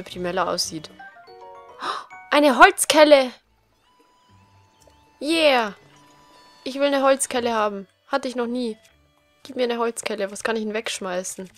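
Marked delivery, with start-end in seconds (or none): 2.3-10.3 loud thrilled cry; over the moon about a spoon; excited. Real, not acted. (überschwängliche Begeisterung)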